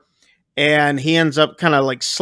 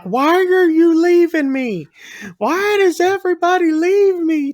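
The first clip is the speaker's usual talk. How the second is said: mock frantic wail; 13 semitones higher than usual. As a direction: imitate her desperate wail of abandonment. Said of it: "acting more and more frantic".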